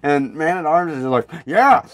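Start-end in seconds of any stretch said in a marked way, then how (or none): 1.4-1.8 higher, louder gleeful burst; imitated hearty agreement; mock eager yeah. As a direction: blurt it with hammed-up gusto. Voices Man-at-Arms eagerly answering.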